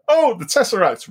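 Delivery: high-pitched mock outburst; loud retold exclamation. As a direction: blurt it out, imitating your excited self. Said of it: "really loudly".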